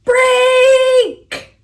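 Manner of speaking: Break. called out high and loud; a sudden loud Break; loud exclaim. Acted. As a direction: shout Break. as the toy snaps. Toy breaks in story.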